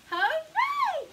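high, loud hooray; joyful shouted hooray. Acted. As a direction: cheer it out for the kids. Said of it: "shout hooray".

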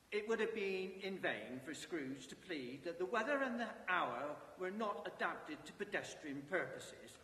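plain reading voice

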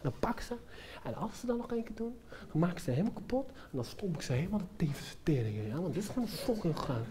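very soft, seething self-talk; muttered fuming. Acted. Performer fumes to himself about the smokers, muttered rage. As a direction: mutter threats under your breath.

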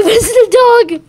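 fast, loud, high yell; shouting. Real, not acted. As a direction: yell it at the dog. Yelling at the dog.

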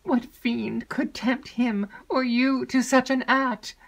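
high, loud, slow outcry; horrified cry. A narrator's reading. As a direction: cry out, chilled with horror. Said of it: "cried Emily, chilled with horror".

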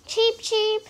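high little chick peeps; chick cheeps. Acted. Ducklings answering their mother, high chick peeps. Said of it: "they say".

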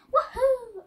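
playful Mario-style whoop; excited cheer. Pretend. Mario cheers on his turn.

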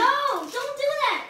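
high, slow loud protest. Real, not acted. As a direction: call out to stop her. Sister cheating at countdown.

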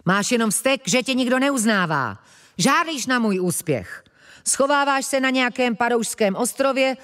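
high-pitched, heated boasting; angry retort. A narrator's reading. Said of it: "odsekl naštvaně Mlask". (naštvaná, vychloubačná odveta)

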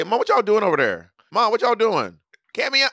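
high-pitched call across the house; calling to family. Shown as a demonstration. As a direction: demonstrate your own way of calling Mom. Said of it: "I say, I would say".

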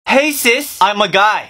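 usual speaking voice

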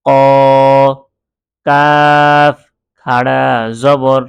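loud, measured recitation with long-held letters; Qur'anic spelling drill. Shown as a demonstration. Teacher spelling out Arabic letters for learners, drawn-out drill recitation. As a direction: chant each letter and sign evenly, stretching the vowels.

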